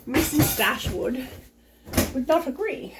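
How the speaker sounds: lightly voiced English lady; English accent. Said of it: "English accent right now".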